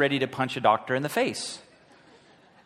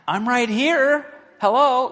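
high, louder, cheery "I'm right here"; God answering; 10 semitones higher, 5 dB louder, slower than usual. Retold or imitated. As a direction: play God waving from close by. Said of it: "He's like".

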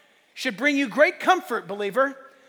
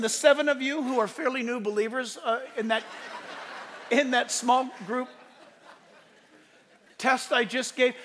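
ordinary talk, nothing marked

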